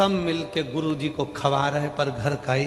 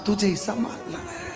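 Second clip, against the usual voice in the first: higher, put-on crying voice; mock tearful; 7 semitones higher, 4 dB quieter than usual. Son dodging the deadly remedy, mock tearful. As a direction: plead tearfully, tailing into a soft high whimper. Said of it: "इतने में बेटा रोते रोते बोले".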